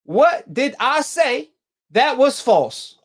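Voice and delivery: very slow, word-by-word question; drawn-out, deliberate questioning; slow, insistent repeat. Real, not acted. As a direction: spell out the question slowly, one word at a time. Repeats the question slowly for emphasis.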